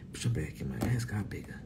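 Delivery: hushed whisper. Real, not acted. Whispering a brag to her friend Candy. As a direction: say it secretly.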